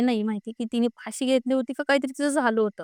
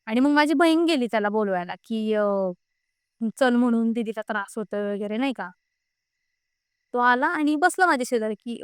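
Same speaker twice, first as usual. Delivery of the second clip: ordinary delivery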